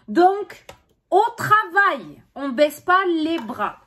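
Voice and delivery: loud, slow, encouraging push; rally them on, slow and loud; emphatic pep talk. Acted. Coaching the viewer to persevere.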